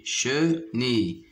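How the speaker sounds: slow "chenille", a gap after che; syllable by syllable. Shown as a demonstration. Teacher locating a sound for pupils, "chenille" split apart. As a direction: separate che from nille in "chenille". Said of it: "se trouve dans la deuxième".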